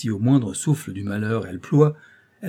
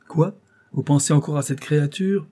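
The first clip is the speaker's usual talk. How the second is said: high, forceful indignant question; scandalised, pitch jumping up; indignant disbelief; 5 semitones higher, 4 dB louder than usual. Acted. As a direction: exclaim it in disapproving surprise. Placeur frowns at Rose's name.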